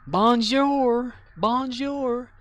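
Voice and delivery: put-on high, loud childlike bonjour; imitating a little boy. Boy greeting customers in French, high and childlike. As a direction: chirp it like an excited kid. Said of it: "he kept going and coming, going".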